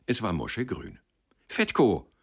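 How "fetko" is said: high, loud call across the gate. A narrator's reading. Boy calling to the porter through the gate, loud call. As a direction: call the porter's name to get his attention. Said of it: "rief er den Pförtner an".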